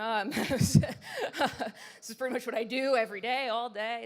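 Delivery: laughing. Real, not acted. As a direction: laugh as you blurt it out. Laughs retelling her reply.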